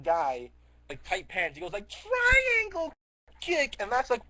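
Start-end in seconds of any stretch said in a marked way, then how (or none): 1.9-3.8 high, loud drawn-out battle cry; mock attack cry. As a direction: yell it like a cartoon fighting move. Acts a fighter's attack cry.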